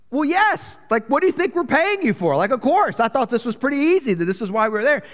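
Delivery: high-pitched, incredulous retort; mock indignation. Husband retelling his retort to the marriage counselor, incredulously indignant. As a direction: push the pitch up as if it's obvious.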